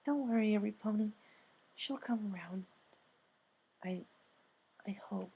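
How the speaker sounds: high, soft, doubtful reassurance; a timid pony fakes confidence and trails off; unconvincing Fluttershy. A narrator's reading. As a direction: try to sound sure and fail, softly. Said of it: "Fluttershy unconvincingly said".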